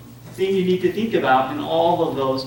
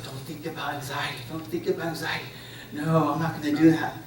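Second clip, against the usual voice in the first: softer, self-directed mock inner voice; suppressing anxiety; 6 dB quieter than usual. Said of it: "Instead of suppressing and saying".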